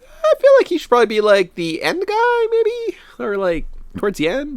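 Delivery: high, squeaky mock hesitation; hammed-up timid second-guessing; doubtful protest. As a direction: tentatively object in a very high voice. Acts out own doubtful reaction.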